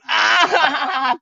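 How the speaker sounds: high-pitched jeering cackle; imitated mocking laughter. Family at the window laughing at him, imitated cackle. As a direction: mimic a crowd cackling at you. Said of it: "pissing themselves off".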